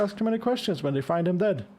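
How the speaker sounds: ordinary talk, nothing marked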